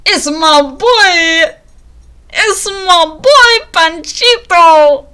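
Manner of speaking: loud, high, drawn-out cheer; excited fan shout. Real, not acted. Fan spotting favourite bird in a quiz, excited shout. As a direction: belt it out, stretching every word in delight.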